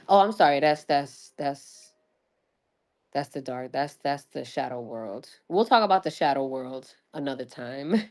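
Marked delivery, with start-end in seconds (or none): 1.4-5.3 hushed. Real, not acted. Teasing about the shadow world.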